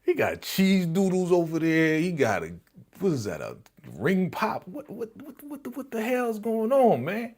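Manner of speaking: hammed-up shocked reaction; shocked outburst. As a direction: squeal what the hell like you can't believe your eyes. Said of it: "I was like".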